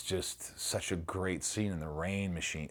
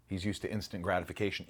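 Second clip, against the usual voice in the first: normal talking voice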